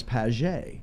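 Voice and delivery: [name] said pa-ZHAY, soft zh; French enunciation. Shown as a demonstration. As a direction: give [name] a French -ay ending. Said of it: "the French enunciation of my name is".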